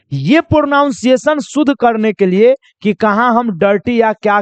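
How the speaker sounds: ordinary talk, nothing marked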